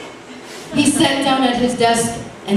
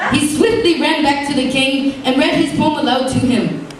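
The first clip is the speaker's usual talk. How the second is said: usual speaking voice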